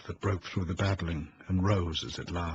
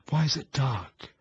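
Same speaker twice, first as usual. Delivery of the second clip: frightened ask; 7 semitones higher than usual. A narrator's reading. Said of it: "she tried to ask".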